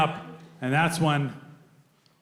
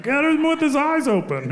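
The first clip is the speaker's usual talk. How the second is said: posing cops; 10 semitones higher than usual. Retold or imitated. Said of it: "They were like".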